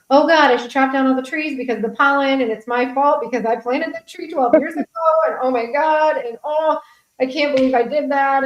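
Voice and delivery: high-pitched, mock-frantic lament; overdramatic self-blame. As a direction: act out a hammed-up overreaction, pitched well up. Overblown self-blame over a sneeze.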